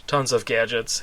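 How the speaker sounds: plain reading voice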